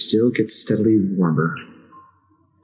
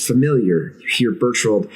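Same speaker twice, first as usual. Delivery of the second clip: ordinary delivery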